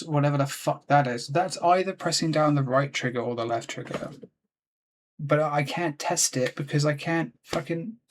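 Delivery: normal talking voice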